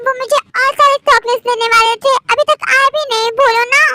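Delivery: put-on a small kid nagging for chocolates; child voice. Teha pesters for her uncle. (ज़िद करती छोटी बच्ची)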